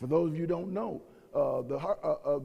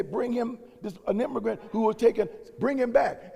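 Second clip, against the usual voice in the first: commanding court order; 6 semitones higher, 4 dB louder than usual. Retold or imitated. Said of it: "court systems that say".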